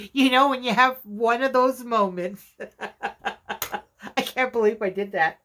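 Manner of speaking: talking through a laughing fit; amused disbelief, words broken by chuckles; real laughter. Real, not acted. Crafter to viewers about one of those moments, laughing. Laughing at her own mistake.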